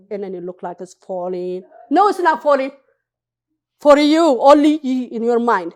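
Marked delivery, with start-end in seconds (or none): 1.9-5.7 loud reassurance. Retold or imitated. Reassuring herself loudly.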